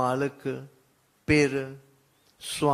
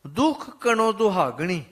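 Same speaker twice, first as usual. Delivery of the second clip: scripture recitation; 5 semitones higher, 5 dB louder, faster than usual. Acted.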